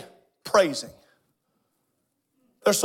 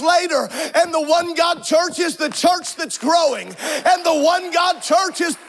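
full-force preaching; 5 semitones higher than usual. Acted. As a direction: drive the close of the sermon home. Preacher climax, shouting and stretching phrases.

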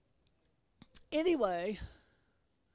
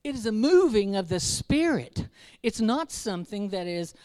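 louder, rising preacher's cry; fervent preaching; 5 semitones higher, 9 dB louder than usual. Acted. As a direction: press it home to the congregation. Preaching builds to full force.

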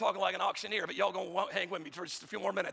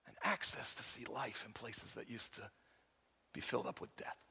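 sudden hush; 7 semitones lower, 10 dB quieter than usual. Acted. Sudden drop after shout.

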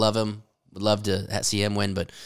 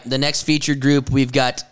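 slow, drawn-out names in announcer style; announcer intro; 3 semitones higher, slower than usual. Acted. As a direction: roll out each player's name ceremoniously. Announces the next featured group.

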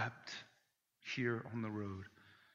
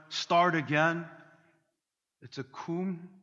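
ordinary talk

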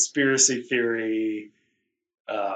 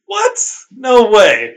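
yelped high and louder, hammed-up shock; sarcastic disbelief; 10 semitones higher, 10 dB louder, slower than usual. Host mocking Seth Green's stoner role, in hammed-up shock. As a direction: act stunned, as a joke.

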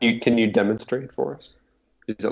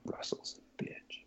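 breathy whispered jab; 22 dB quieter than usual. Real, not acted. Cohost whispering an insult about absent Russell.

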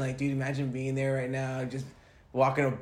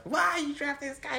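mock booing fan; 14 semitones higher than usual. Imagining booing at the draft.

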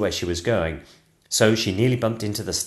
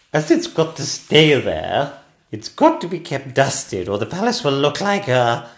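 high, loud regal voice; a queen insisting, broken off mid-sentence; complaining Queen; 6 semitones higher, 6 dB louder than usual. Acted.

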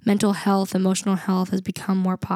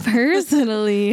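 drawn out through a laugh; playful, laughing echo; 4 dB louder, slower than usual. Real, not acted. Speaker echoing cohost's request to get personal, laughing and playful. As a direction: stretch it teasingly, amused.

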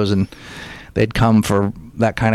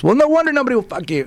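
high, fast mock heckler shout-out; imitating a heckler butting in; heckler across room; 11 semitones higher, faster than usual. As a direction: call it across the room, pitched up and quick. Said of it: "a guy from across the room goes".

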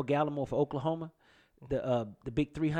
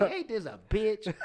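mock disbelief; 6 semitones higher, slower than usual. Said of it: "I was like".

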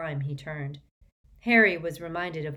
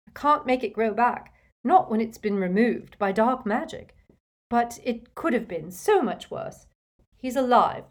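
higher, louder motherly distress; an upset mother fretting over her hurt son; 6 semitones higher, 7 dB louder than usual. A narrator's reading. Distraught mother tending injured son.

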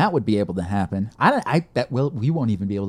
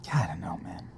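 soft whispered resignation; 7 semitones lower, 10 dB quieter than usual. Real, not acted. Friend sighing about the con, whispered. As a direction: whisper it, giving up.

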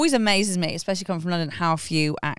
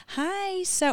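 mock timid greeting; 12 semitones higher, 6 dB quieter than usual. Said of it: "You don't have time to be like".

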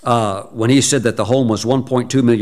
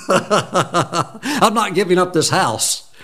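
laughing; 6 semitones higher, much slower than usual. Retold or imitated. Wife refuses, speaker laughing.

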